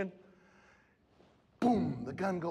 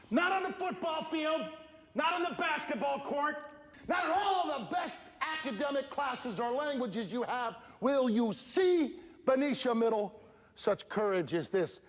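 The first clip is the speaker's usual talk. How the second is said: preaching; 8 semitones higher than usual. Acted. Speaker praising the boy's courage to the school, preaching. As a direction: proclaim it to the whole hall.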